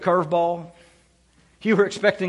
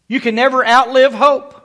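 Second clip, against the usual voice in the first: louder and higher, outlive rising; louder preaching repeat; 5 semitones higher, 6 dB louder than usual. Real, not acted. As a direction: lift it up and ring out on outlive. Pastor repeats line for emphasis.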